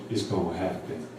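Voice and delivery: low, soft and smooth; cool, quiet assurance, imitating a rapper. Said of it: "real smooth, real cool".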